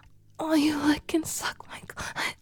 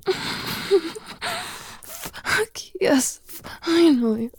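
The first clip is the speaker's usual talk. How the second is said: sudden loud burst of delighted relief with a high laugh; excited relief; 6 semitones higher, 7 dB louder, much slower than usual. Acted. To her partner after he finally gives in, a loud relieved burst. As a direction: break out loud with a laugh, then drawn out.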